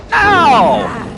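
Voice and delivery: loud, high yelp; sharp no. Real, not acted. Streamer yelling as Claudette heads away, loud and high. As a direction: cry out in protest. Survivor goes for door.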